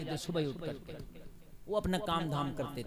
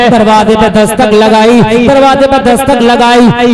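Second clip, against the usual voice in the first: full-force preaching; 4 semitones higher, 29 dB louder than usual. Acted. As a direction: chant the tale to the crowd, stretching the key lines. Chanted story of Umar, sermon. (Josheela was, लंबी खिंची ऊँची आवाज़)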